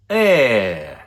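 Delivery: "eh" as an open Russian e vowel; Russian sound eh. Shown as a demonstration. Teacher showing the Russian э sound, pronunciation demo. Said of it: "the first one is".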